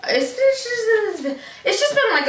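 high laughing words. Real, not acted. Laughing at herself.

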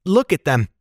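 high, understated exclamation; crying out. A narrator's reading. Young guide objecting to rooftop plan, mild cry. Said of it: "cried Jem".

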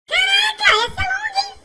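high, amazed shout; wow, that one's long; surprised exclamation. Real, not acted. Sees a long race stretch.

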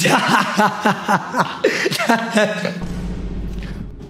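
high, prolonged burst of laughter; long belly laugh. Real, not acted. Streamer cracking up at a caller's joke, long laugh. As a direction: crack up and keep going.

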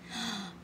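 little excited gasp. Acted. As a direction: gasp with delight. Petey sees boy in car.

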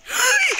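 sharp, high loud gasp; startled gasp. Real, not acted. Player startled mid-game, shocked gasp. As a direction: suck in air in sudden shock.

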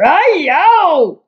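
high, drawn-out giant's yowl; roar. A narrator's reading. Sleeping giant in a nightmare, roaring. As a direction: howl it out high like a giant in pain. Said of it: "roared the flesh lump eater".

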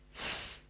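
"S" as a long voiceless hiss, no vowel; letter sound. Shown as a demonstration. Teacher to child showing the sound of the letter s. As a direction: hiss the "S" like a snake.

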